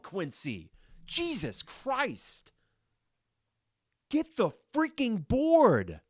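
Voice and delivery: high, loud, furious yelling at the screen; drawn-out exasperated shouts at a player who won't rebound; fired-up yelling at the player. Real, not acted. Podcast host yelling at Quincy for missing rebounds, furious high shouting. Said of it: "I got fired up there".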